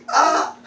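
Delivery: high, playful exclamation; imitated excited "ah". Preacher teasing about her style, mock excited cry. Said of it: "then she expressed like this".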